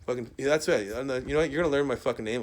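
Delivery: ordinary delivery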